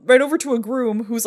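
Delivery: plain reading voice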